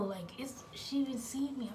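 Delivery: hushed scared whisper. Retold or imitated. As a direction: whisper it under your breath. Retelling a frightened secret thought.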